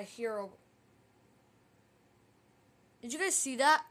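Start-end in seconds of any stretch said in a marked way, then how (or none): 3.0-3.8 loud startled shout; yelling in sudden fright; excited shouting. Real, not acted. Spooked by Herobrine message.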